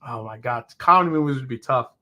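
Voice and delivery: normal talking voice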